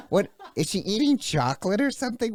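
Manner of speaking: high, fast, laughing disbelief; laughing, incredulous. Real, not acted. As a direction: crack up while asking, pitch shooting up. Laughing at his own impression.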